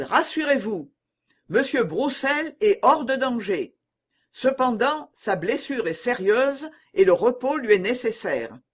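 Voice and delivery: high, projected public reassurance; calling to a crowd. A narrator's reading. Coadjutor tells the people Broussel is safe, calling to a crowd. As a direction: address the street from a window.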